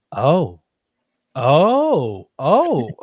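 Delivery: loud "oh"s, swooping up in pitch; acted-out astonished understanding; dawning realization. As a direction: let each "oh" land bigger as it clicks. Acts out realization on seeing box.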